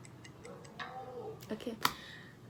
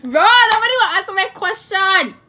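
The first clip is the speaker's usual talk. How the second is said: loud, higher frustrated complaint; frustrated outburst; 6 semitones higher, 11 dB louder than usual. Real, not acted. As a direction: yell it out, fed up. Frustrated nobody answers her.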